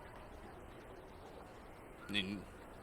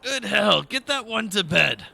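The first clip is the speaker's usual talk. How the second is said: high, loud, disgusted passerby; disgusted bystander; 11 semitones higher, 10 dB louder than usual. Acted. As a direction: shout it out like an offended townsperson. Civilian NPC voiced by GM.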